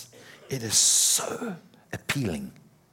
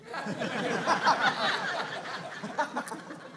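long high laugh; 13 semitones higher, 4 dB quieter than usual. Real, not acted. Laughing at own joke.

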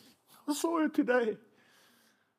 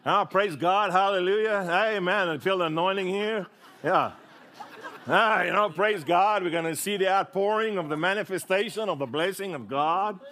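American preacher; 5 semitones higher than usual. Retold or imitated. Pastor mocking American-style preaching in a hammed-up American accent. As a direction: ham up a fired-up American pastor. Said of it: "start speaking American".

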